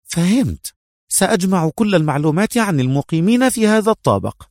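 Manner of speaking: high-pitched, ready acknowledgement; obliging female agent. A narrator's reading. Mona promises Adham the information, obliging.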